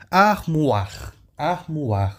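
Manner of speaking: "Armoire" with oi said as wa; French pronunciation. Shown as a demonstration. Teacher modelling for students to repeat, French pronunciation.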